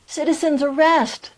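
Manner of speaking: loud, high call-out; yelling. A narrator's reading. Man repeating his arrest to the parents, loud yell. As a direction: shout it out as a repeated announcement. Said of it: "I heard a man yell".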